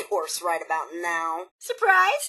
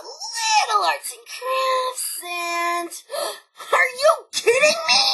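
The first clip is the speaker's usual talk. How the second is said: surprised outrage; 12 semitones higher, slower than usual. Acted. Finds missing word in data.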